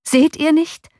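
high, loud desperate call; desperate cry. A narrator's reading. Coachman crying out in despair to his master. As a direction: cry out in alarm at the mob. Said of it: "rief der Kutscher verzweifelt".

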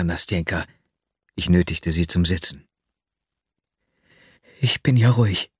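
ordinary delivery, nothing marked

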